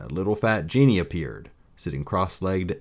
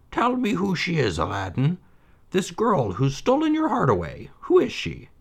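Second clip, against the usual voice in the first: put-on high old mother's voice, dismissive; 11 semitones higher than usual. A narrator's reading. Mother doubting her son's tale, high and dismissive.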